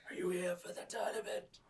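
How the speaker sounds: high, whispery elder; strained, hushed old wizard question; raspy old man. Acted. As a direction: wheeze it like a ninety-year-old. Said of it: "he has a really raspy, like, strained old man voice".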